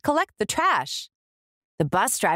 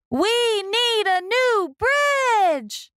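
much higher, slow proclamation; drawn-out rallying cry; 12 semitones higher, much slower than usual. Acted. Mayor declaring the town needs a bridge, rousing. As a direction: announce it like a big idea.

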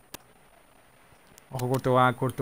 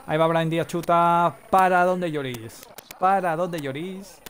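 higher, louder call, stretched out at the end; excited commentator cry; 5 semitones higher, 5 dB louder, slower than usual. Pretend. Commentator reacting to a shot at Lloris, drawn-out excited cry. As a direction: draw out the cry as the shot comes.

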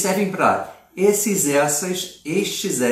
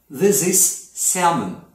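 slow demo sentence; 2 semitones higher, much slower than usual. Shown as a demonstration. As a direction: model it carefully for the class. Teacher demonstrating English sentence.

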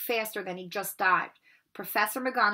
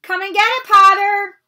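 much higher, loud taunting call; 11 semitones higher, 16 dB louder than usual. Acted. Malfoy calls down from above the oak, loud taunting shout. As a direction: shout the dare down from the tree. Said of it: "he called".

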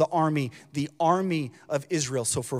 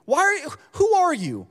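angry big brothers; 9 semitones higher, 4 dB louder than usual. Retold or imitated. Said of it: "They say".

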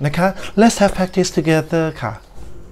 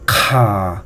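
"ka" low and sinking, drawn out; long low-pitched "ka", no rise; 7 semitones lower than usual. Shown as a demonstration. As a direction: let "ka" settle down at the bottom of the voice. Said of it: "low tone".

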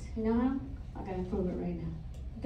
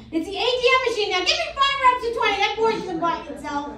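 hyped gym coach; 9 semitones higher, 4 dB louder, faster than usual. Acted. Gym advice to grandpa.

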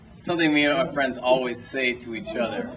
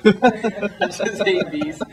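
laughing; 8 semitones higher, 5 dB louder, much slower than usual. Real, not acted. Laughing while recalling the bees line.

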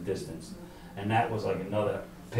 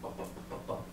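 whispered mock helicopter bup bup; helicopter thump; 15 dB quieter than usual. A faint mock helicopter beat, whispered to the host. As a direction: breathe out a soft chopper thump. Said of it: "all of a sudden I hear a noise".

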